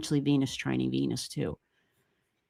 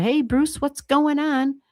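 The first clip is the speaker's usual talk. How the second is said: cheery greeting; 8 semitones higher, slower than usual. Real, not acted. Greeting viewer in chat.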